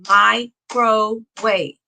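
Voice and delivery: "microwave" slow and separated, mi, cro, wave; split into syllables. Shown as a demonstration. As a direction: break "microwave" into three clapped beats, mi, cro and wave. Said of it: "Three claps in that word".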